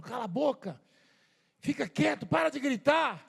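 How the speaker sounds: imitated sharp, high-pitched order to hush; mock scolding. Retold crowd silencing the blind man. (bronca imitada, em voz aguda)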